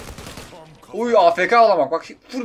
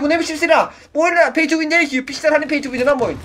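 usual speaking voice

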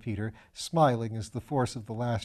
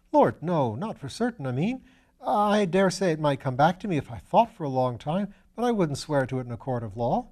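put-on higher, bright-eyed young medical student voice; eager young student; 4 semitones higher, 3 dB louder than usual. Young Piggott protesting to Lord Peter, higher eager student voice. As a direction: play the eager, slightly foolish young student.

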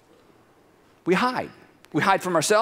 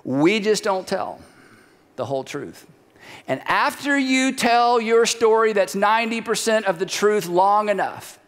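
ordinary talk

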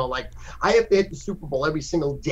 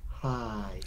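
sudden sweet hi; 4 semitones lower, 12 dB quieter than usual. Retold or imitated. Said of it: "that's literally how insane it is".

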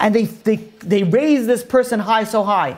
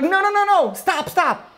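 screamed plea; 13 semitones higher, slower than usual. Retold or imitated. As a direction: cry out desperately to halt it. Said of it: "a voice from the crowd that screams out".

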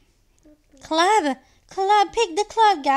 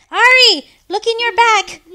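loud, high call to a teammate; urgent raised-voice call; shouted warning; 5 semitones higher, 10 dB louder than usual. Real, not acted. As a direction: shout to make them turn round. Calling out a warning to Ari.

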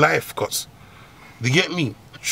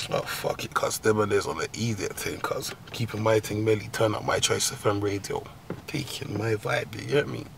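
soft, low, unbothered mellow voice; laid-back calm; 5 semitones lower, 8 dB quieter than usual. Pretend. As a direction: drift off, relaxed and chill. Acting out calm while high.

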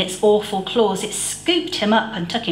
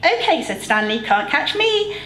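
put-on teasing boy; 7 semitones higher than usual. A narrator's reading. Stan teasing while playing.

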